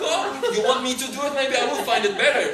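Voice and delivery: high, loud exasperated offer. Retold or imitated. Father to nurse who can't find the vessel, exasperated. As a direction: burst out at someone's fumbling.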